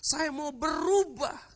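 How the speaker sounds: high, loud, drawn-out plea to God; imitating tearful prayer. Preacher voicing a weeping convert begging God for change, loud tearful plea. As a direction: cry out the prayer, stretching the words, as a retelling. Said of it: "dia menangis lagi".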